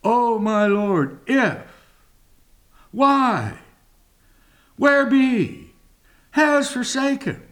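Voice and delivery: doubting complaint to the Lord; language of doubt. Retold or imitated. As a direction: voice a doubting man asking why. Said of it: "Language of doubt".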